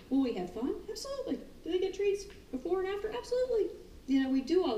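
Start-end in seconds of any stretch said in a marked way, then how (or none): 0.9-1.4 high bright emphatic absolutely; emphatic yes. Real, not acted. Trainer insisting on fun, high emphatic. Rhetorical answer about treats. 3.2-3.7 high bright emphatic absolutely; emphatic yes. Real, not acted. Trainer insisting on fun, high emphatic. Rhetorical answer about treats.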